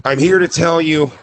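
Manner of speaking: slow, earnest forgiving tone; mock solemn forgiveness. Justin faces the dog that bit him, mock solemn. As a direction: forgive with staged sincerity. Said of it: "he goes".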